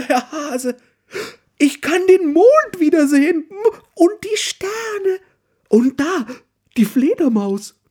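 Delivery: very high, loud, thrilled bear voice; excited call. A narrator's reading. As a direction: cry out in delight at the moon and stars. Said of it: "ruft sie begeistert".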